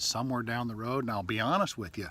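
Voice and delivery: normal talking voice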